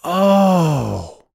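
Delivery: high, drawn-out oh; high exclamation of discovery; sudden realization. A narrator's reading. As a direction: let the realization burst out, pitch falling.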